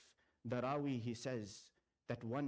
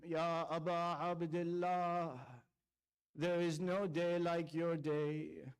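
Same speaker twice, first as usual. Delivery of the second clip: impassioned preaching. Acted. Preacher recounting Ashura to mourners, impassioned lament. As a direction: pour grief into every phrase, building. Preacher's rising Karbala recitation.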